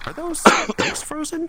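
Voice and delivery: high, loud surprised question. Real, not acted. Startled by something on screen.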